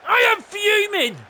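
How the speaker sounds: high, loud burst of anger; fuming. Real, not acted. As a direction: let the fury out loud.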